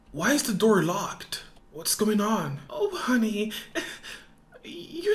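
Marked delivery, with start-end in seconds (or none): none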